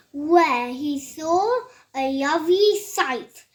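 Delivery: plain reading voice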